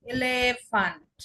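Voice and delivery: "এলিফ্যান্ট" slowly as eh-leh-FAHNT, E as "eh"; German pronunciation. Shown as a demonstration. Teacher giving an example of German E, slow German pronunciation. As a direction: draw out "এলিফ্যান্ট" with long German "eh" sounds. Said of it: "আর আমরা ডয়েসে বলবো এলিফ্যান্ট".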